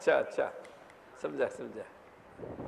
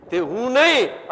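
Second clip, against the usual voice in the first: slow, louder, stress on નહીં; emphatic denial; 4 semitones higher, 6 dB louder, slower than usual. Shown as a demonstration. Guru teaching disciples, stressing નહીં slowly and loudly. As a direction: lean hard on નહીં to mark the point. Guru contrasting self and body.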